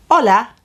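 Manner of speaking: Hola with the H not heard, OH-la; Hola, bright greeting, no h sound; silent h. Shown as a demonstration. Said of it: "With an H, which we don't hear".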